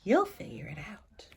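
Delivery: whispered. A narrator's reading. Parents whisper to their sleeping daughter, tender whisper. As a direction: breathe it gently like a goodnight. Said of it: "They kissed her and whispered".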